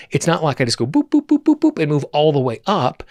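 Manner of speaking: playful boops. Retold or imitated. Playful sound for quick jumps.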